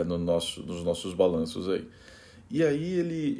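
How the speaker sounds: normal talking voice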